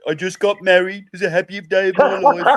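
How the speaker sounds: joyful news in a whiny Brummie accent; Birmingham accent. Shown as a demonstration. Showing friends how Birmingham sounds like complaining, mock Brummie accent. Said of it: "The Birmingham accent is terrible".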